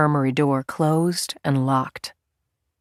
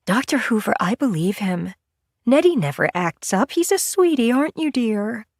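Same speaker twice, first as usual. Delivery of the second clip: sweet nurse; 6 semitones higher than usual. A narrator's reading. Nurse Mimi vouching for Nettie.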